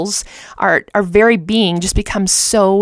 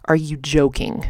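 disbelieving mutter; 4 semitones lower, 6 dB quieter than usual. Retold or imitated. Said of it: "And I thought".

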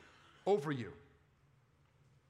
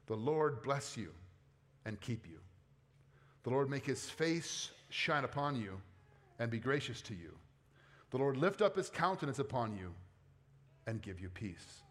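solemn scripture reading; 5 semitones lower than usual. Acted. Pastor reading the blessing to the congregation, hushed and solemn. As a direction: read the blessing like a gentle prayer.

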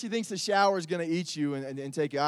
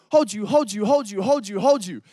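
put-on loud pleading child voice, repeating; imitating a scared toddler calling hold you; 6 dB louder, slower than usual. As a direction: beg like a frightened little girl. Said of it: "would go".

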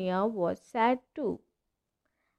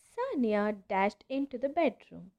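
plain reading voice

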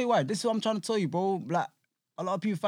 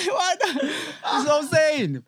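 laughing; 11 semitones higher, 7 dB louder, slower than usual. Real, not acted. Laughing at his own claim.

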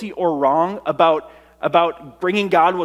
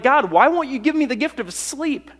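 desperate plea; 7 semitones higher than usual. Pretend. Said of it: "And you're like".

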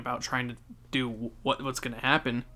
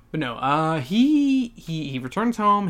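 ordinary delivery

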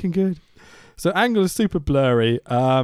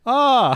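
louder, higher mock yell; imitated stabbing cry; 8 semitones higher, 5 dB louder than usual. Actor stabbed in dream turns to camera, mock cry. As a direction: act out a badly dramatic scream. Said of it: "turns the camera and goes".

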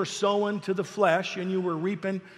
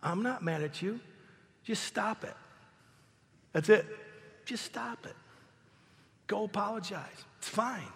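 much softer, calm and patient; God's gentle voice; 9 dB quieter than usual. Retold or imitated. God gently answering the sorry husband, soft and calm. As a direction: reassure him quietly, unhurried. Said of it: "He said".

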